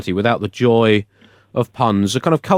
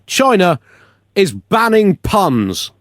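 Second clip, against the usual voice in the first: slow, higher, weighty announcement; dramatic reveal; 7 semitones higher, much slower than usual. Acted. Host reveals the outrageous news to John, mock-grave. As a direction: land the headline with mock gravity.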